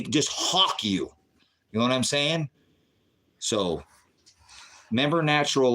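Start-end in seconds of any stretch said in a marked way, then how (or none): none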